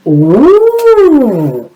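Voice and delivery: gasp, then long high ooh; drawn-out, playful ooh of discovery; amazed gasp. Pretend. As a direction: show wide-eyed wonder for children. Pretend amazement at bag's contents.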